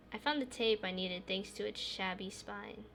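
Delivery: ordinary delivery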